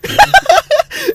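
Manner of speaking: loud, high laugh; real high giggling outburst; burst of laughter. Real, not acted. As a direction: crack up at your own joke. Laughs at his own lopsided offer.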